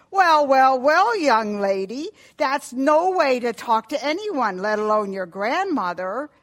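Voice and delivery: loud, high, reproachful telling-off; scolding father. Acted. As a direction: pull up a child sternly for rudeness. Father scolds daughter for shouting.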